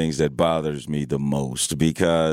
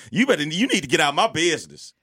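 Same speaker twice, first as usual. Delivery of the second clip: indignant retort; 8 semitones higher, much faster than usual. Acted. Retorts as annoyed phone talker.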